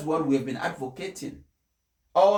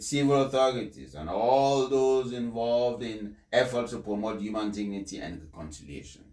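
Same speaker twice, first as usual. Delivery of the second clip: measured, solemn address read from the text; formal speech reading; 4 dB louder than usual. Acted. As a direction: deliver it like a papal address. Reads the Pope's speech aloud.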